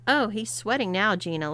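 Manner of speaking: usual speaking voice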